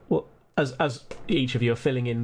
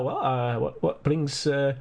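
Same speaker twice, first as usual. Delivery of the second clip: lightly Scottish curious gent; Scottish accent, curious. Acted. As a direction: fish for info in a Scottish lilt. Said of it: "he says".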